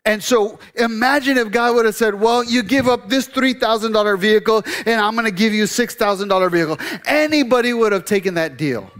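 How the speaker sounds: high, loud sermon build; full-force preaching. Acted. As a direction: preach it hard to the congregation.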